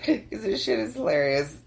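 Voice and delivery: ordinary talk, nothing marked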